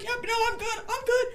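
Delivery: very high, squeaky mock reassurance; tearful denial. Crying worker fending off friends, squeaky tearful denial. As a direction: squeak it out through pretend tears. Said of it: "And I was like".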